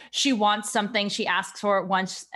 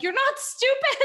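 high reassuring voice. Retold or imitated. Reassuring child who calls self stupid.

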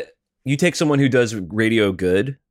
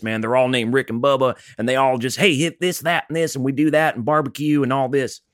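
higher-pitched Alabama morning-show DJ patter; Southern radio DJ; 5 semitones higher than usual. Acted. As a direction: play a Southern rock-radio jock. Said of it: "kinda talk like this".

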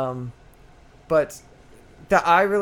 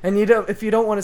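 louder, fast announcer welcome; podcast host intro; 5 dB louder than usual. Acted. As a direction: kick off the show with energy. Show intro host read.

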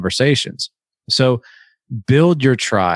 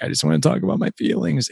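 higher and softer, a fast teasing whine; mock weepy man; 8 semitones higher, 4 dB quieter, faster than usual. Mocking men who think vulnerability is weepy, in a high soft whine. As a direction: poke fun at a man weeping over feelings. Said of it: "you weeping and crying and like".

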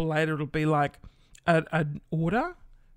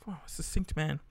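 normal talking voice

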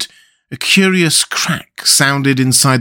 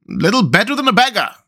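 much higher, fawning echo; echoing councillors; 9 semitones higher, faster than usual. A narrator's reading. Councillors echoing the mayor, high fawning echo. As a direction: eagerly parrot the mayor. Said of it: "cried the town councillors".

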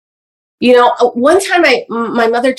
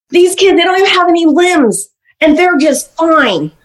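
scolding mother; 7 semitones higher, 3 dB louder than usual. Retold or imitated.

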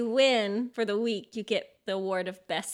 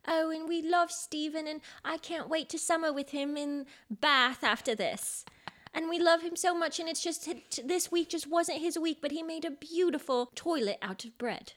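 lightly voiced British contestant, higher; British accent; 7 semitones higher than usual. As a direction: gush like a polite British baker, in a British accent. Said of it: "in that British competition way".

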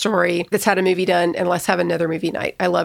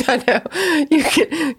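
laughing; 7 semitones higher, slower than usual. Real, not acted. Laughs at being teased.

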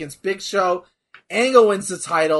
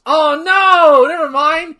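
excited; 9 semitones higher, 8 dB louder, slower than usual. Real, not acted. Watching the finish live.

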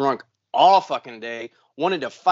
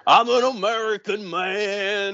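high, slow hammed-up patriot; mock proud patriot voice; mock patriotic American; 6 semitones higher than usual. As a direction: ham up a flag-waving American. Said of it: "patriotic country music".

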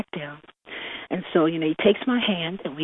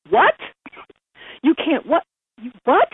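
high, loud incredulous outburst; shocked disbelief; 8 semitones higher, 5 dB louder than usual. Retold or imitated. Girl reacting to being dumped on the bench, shocked. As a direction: fire back each question in stunned disbelief. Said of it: "I was so shocked".

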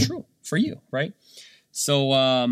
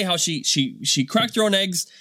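normal talking voice

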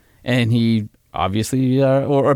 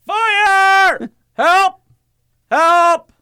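much higher, loud yelling of fire and help; shouted for help; 4 dB louder, much slower than usual. Retold or imitated. Dad retelling how he called for help, loud yell. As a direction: yell out like the truck is burning. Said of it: "I yelled".

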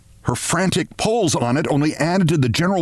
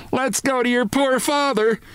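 1 frantic mother; 10 semitones higher than usual. A narrator's reading. Mother calling out in panic.

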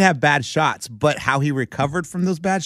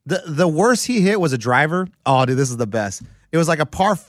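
ordinary delivery

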